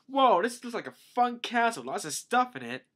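high, loud wide-eyed wonder; amazed exclamation. Retold or imitated. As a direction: exclaim like a thrilled visitor. Voicing Luffy amazed at the castle.

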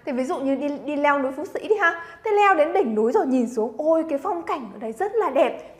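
usual speaking voice

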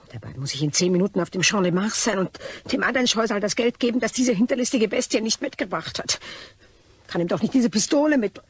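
loud, fast, rising furious rant; angry rant. Acted. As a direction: vent the rage at being cheated. Furious rant about swindlers. (wütende, schnelle Schimpftirade)

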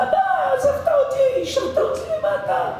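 put-on very high, whiny child's complaint; high tearful child's voice, half laughing; hurt child. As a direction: whimper it like an abandoned boy. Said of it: "הוא אומר".

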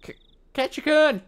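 very high, drawn-out shout; calling out. Acted. Rina calls Keiichi as she cycles up, high loud shout. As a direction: stumble on the first syllable, then yell it.

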